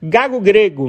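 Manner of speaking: normal talking voice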